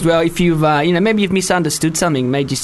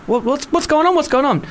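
put-on high, eager child's question; small child asking; 10 semitones higher than usual. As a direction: pitch it up like a curious kid.

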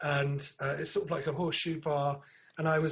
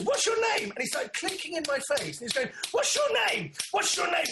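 imitated pushy fan; 11 semitones higher than usual. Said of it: "He goes".

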